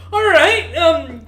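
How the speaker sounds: loud, high-pitched, through laughter; laughing. Real, not acted. Game master reacts to the player chopping off fingers, laughing. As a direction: burst out laughing on the words.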